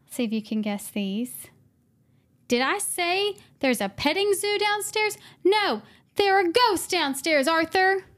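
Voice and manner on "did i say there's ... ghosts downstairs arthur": movie quote. Retold or imitated. Quoting Thirteen Ghosts line.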